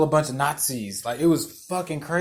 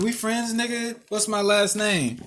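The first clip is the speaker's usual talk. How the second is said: higher-pitched mock disbelief; incredulous retort; 7 semitones higher than usual. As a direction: challenge the claim of friendship. Acted retort to claimed friendship.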